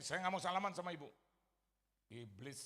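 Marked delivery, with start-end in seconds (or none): 0.0-1.1 loud, indignant mock refusal; raised voice, acting out a refusal. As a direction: protest loudly as if refusing someone outright. Imagined blunt refusal, voice raised.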